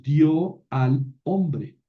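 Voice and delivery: dio al hombre drawn out slowly; slow translation. Shown as a demonstration. (traducción lenta y pausada)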